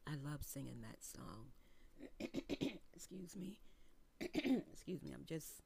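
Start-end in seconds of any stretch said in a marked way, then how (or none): 1.5-2.9 a short throat clear. Real, not acted. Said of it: "Clearing my throat here". 3.6-4.2 a quick quiet throat clear. Real, not acted. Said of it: "Clearing my throat here".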